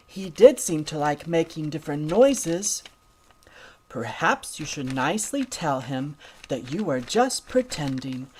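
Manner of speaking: ordinary delivery, nothing marked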